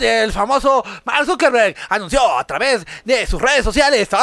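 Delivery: news read. Acted. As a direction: parody newscaster read. (lectura de noticiero)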